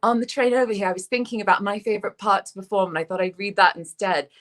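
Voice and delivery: lightly imitating an English actor's British accent. Mimicking the British actor's polite British accent. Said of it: "in his lovely British way".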